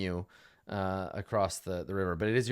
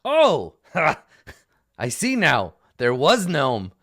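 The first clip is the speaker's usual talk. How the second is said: loud, high gnoll voice in broken English; a gnoll startled to spot the gnome; surprised gnoll; 4 semitones higher, 8 dB louder, slower than usual. Acted. Gnoll at the river spots the gnome, loud and surprised. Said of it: "and he's like".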